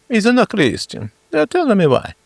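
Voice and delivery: very high, hammed-up Italian accent; overacted cartoon Italian accent; cheesy Italian accent. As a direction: play it as a squeaky comic Italian. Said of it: "I do the cheesiest accents".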